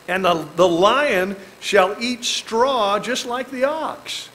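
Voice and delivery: slow, solemn reading of the verse; scripture reading. Acted. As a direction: read the verse slowly and solemnly.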